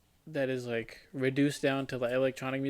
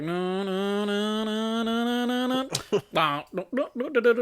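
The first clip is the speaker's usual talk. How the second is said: chanted no's climbing steadily higher; mock build-up; 9 semitones higher, 4 dB louder than usual. Mocking a weak EDM drop for friends, chanted rising. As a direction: mimic a synth riser getting higher and higher.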